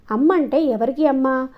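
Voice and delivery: usual speaking voice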